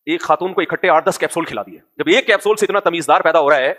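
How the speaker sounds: usual speaking voice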